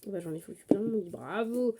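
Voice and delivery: usual speaking voice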